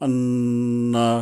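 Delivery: আন্না held very slowly with a humming nasal ন্ন like a flute; very slow nasal hum on আন্না; nasal ghunna. Shown as a demonstration. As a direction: stretch the ন্ন in আন্না through the nose. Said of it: "নাকের ভিতর থেকে একটু".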